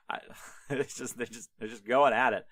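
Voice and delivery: ordinary delivery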